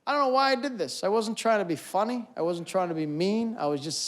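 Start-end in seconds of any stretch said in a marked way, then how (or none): none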